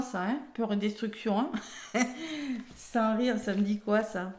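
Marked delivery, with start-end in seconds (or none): 1.5-2.6 short amused laugh; a quick laugh at the card. Real, not acted.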